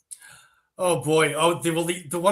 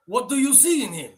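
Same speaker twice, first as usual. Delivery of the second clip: put-on higher-pitched Russian-accented question; 8 semitones higher than usual. The guy asking her about his rival, Russian accent. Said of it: "if I have to do a Russian accent".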